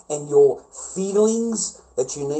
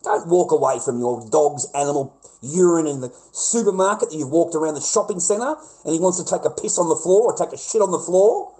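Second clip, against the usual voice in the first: ordinary delivery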